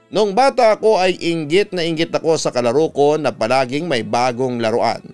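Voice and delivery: ordinary delivery, nothing marked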